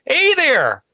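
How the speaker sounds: high, cheery ranger voice; park ranger voice. Retold or imitated. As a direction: call out like a chipper ranger. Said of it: "we wake up to a guy going".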